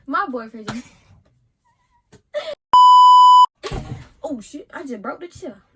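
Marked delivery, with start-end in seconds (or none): 2.1-4.2 loud burst of laughter. Real, not acted. She just broke the chair.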